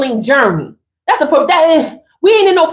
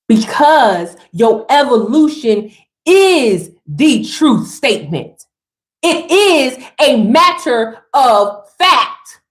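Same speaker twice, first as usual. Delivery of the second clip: slow, word-by-word statement; slow and deliberate, landing every word; emphatic declaration; 2 semitones lower, slower than usual. Real, not acted. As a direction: space out each word for weight.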